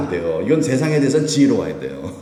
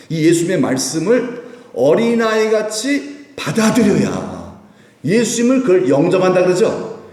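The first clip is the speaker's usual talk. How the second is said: fervent preaching; 4 semitones higher than usual. Acted. Preaching at full force.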